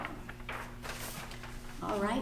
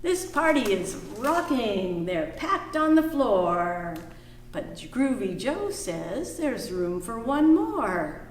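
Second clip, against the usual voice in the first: put-on reading to children; 3 semitones higher than usual. Teacher reading a picture book aloud to the class.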